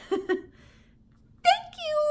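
high squeaky hamster voice; squeaked. A narrator's reading. The hamster thanks his cheering class in a high squeak. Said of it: "I squeaked".

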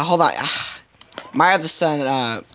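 ordinary delivery

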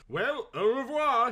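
put-on au revoir in a French accent, slow; airy French au revoir. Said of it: "with a good French accent".

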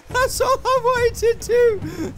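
very high, drawn-out whine; exasperated wail. Real, not acted. Wailing at failed button press.

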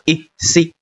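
"一" then "C", sound by sound, rising; phonics blending demo. Shown as a demonstration. Teacher sounding out letter C before Y, phonics blending demo. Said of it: "读的是".

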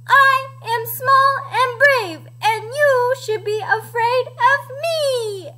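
high, slow, defiant little hen; Edna. A narrator's reading. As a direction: draw it out high and bold. Chicken stands up to dinosaur.

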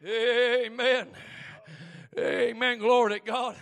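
loud, high, fervent revival preaching; hollering Southern preacher; preaching at full force. Acted. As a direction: whip the congregation up.

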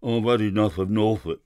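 thick-voiced, half-drunk servant; boozy, thick-tongued announcement; half-drunken man. A narrator's reading. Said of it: "in the thick voice of a half-drunken man".

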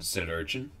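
ordinary delivery

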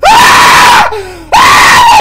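shrill startled shriek; high scream. Real, not acted. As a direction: scream in real fright. Startled by game event.

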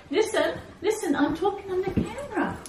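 pleading with the dogs. Real, not acted. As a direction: beg them to settle. Scolding dogs mid-recording.

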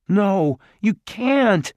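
high, loud, slow cry; desperate. A narrator's reading. Jared protesting as they're taken away, desperate. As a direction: plead against it.

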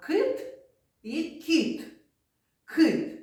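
«Кит» and «кіт» high, crisp and distinct; high, clear sound contrast «Кит» versus «кіт»; sound contrast demo. Shown as a demonstration. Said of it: "teacher contrasts hard and soft К".

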